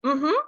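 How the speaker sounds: hummed yes, rising at the end; agreeing mm-hmm. Shown as a demonstration. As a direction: affirmative closed-mouth hum lifting up. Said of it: "If I say this sound".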